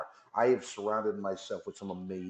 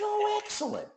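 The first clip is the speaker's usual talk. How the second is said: much higher, bright mock-wife squeal; delighted wife. [name] imitating his wife hearing he's coming home early, high and delighted. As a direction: pitch it way up like a thrilled wife. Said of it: "She said".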